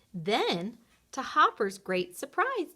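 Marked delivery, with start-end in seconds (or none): none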